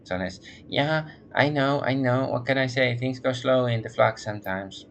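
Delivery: lightly voiced nasal woman, all business; businesslike nasal woman. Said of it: "becomes more businesslike".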